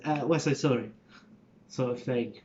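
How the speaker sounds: normal talking voice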